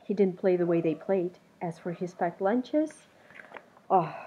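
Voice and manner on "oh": ordinary delivery, nothing marked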